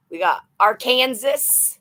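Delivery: Arkansas said as Ar-Kansas, on purpose; deliberately wrong. Shown as a demonstration. Reading out a viewer's state, mispronouncing it on purpose. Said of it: "I like to say the stuff wrong on purpose".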